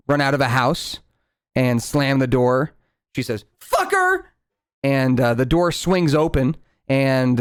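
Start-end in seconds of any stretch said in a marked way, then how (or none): 3.6-4.2 high yelling woman, furious at cheating partner; angry woman. Acted. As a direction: shriek it like a betrayed woman. GM voices woman slamming door.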